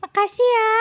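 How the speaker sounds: high, loud, pleased cat voice; grateful cat. Pretend. Cat thanks Cici for carrying it, high cat voice.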